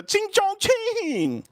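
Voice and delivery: very high, drawn-out mocking screech; caricatured high-pitched calling out; mock scream. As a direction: stretch the last syllable in a high mock yell. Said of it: "she screams at her other friend".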